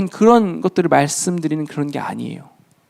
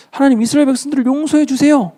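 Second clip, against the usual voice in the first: higher-pitched, retold plea for mercy; 간청하는 기도; 6 semitones higher than usual. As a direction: plead upward as Moses would, retelling it. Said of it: "모세가 계속 간청했습니다". (높은 음으로 간청하듯 전하는 기도)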